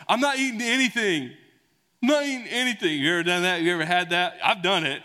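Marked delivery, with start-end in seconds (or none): 0.1-3.0 mock tantrum, high and loud; complaining kid. Kid refusing parents' dinner offer, high loud protest. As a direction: imitate a kid stubbornly refusing food. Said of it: "one of our kids was like".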